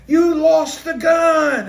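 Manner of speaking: loud, high, stretched-out shout; retold shout. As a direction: call it out as the man catching up mid-escape. Acted quote of the accountant.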